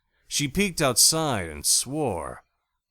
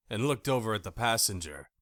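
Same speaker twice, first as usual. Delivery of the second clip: ordinary delivery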